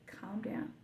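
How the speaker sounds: whispered. Retold or imitated. Rescuer's words retold.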